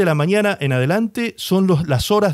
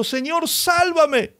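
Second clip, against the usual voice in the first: cry for help; 11 semitones higher, much slower than usual. Acted. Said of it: "dio voces diciendo".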